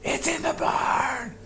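high strained whisper-shout; whisper yelling. Acted. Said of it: "whisper yell".

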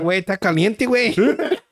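laughing Spanish impression of a hot worker; mock Mexican worker. Joking about landscapers in the heat, laughing Mexican voice. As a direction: toss it off like a worker in the sun. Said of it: "It's like".

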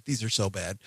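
ordinary talk, nothing marked

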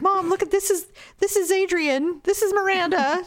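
lightly voiced excited daughter; eager daughter. Mother imitates daughter showing kittens, mildly eager.

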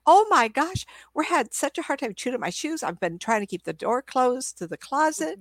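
high-pitched, exclaiming pet owner; exasperated dog owner. Retold or imitated. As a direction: imitate an owner exclaiming over chewed shoes. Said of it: "But this girl".

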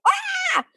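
sudden high-pitched yelp of alarm; panicked yelp. Retold or imitated. Student overwhelmed by the teacher's counted commands, high yelp. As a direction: let out a short shocked cry, way up high. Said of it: "and it's like".

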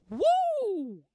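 high, loud whoop of delight; shrieking fan whoop; excited whoop. Real, not acted. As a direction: let out a thrilled woo. Cheering the line Rhaenyra gives.

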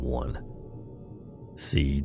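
usual speaking voice

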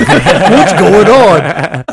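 loud, drawn-out panicked cry through laughter; hammed-up panicked yell; mock freak-out. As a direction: act out a gamer freaking out, long and loud. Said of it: "freaking out while playing the game".

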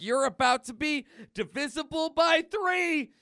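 high, loud, building threat; hammed-up superhero attack line; battle taunt.

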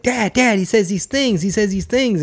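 high-pitched eager kid impression; excited son. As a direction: imitate a child breathlessly tattling to dad. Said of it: "he's excited".

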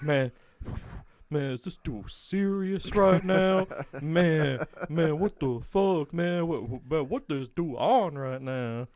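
mock indignant inmate grumbling; mad self-talk. Inmate griping about the guard, mock mad. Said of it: "he's just mad, like, talking shit to himself".

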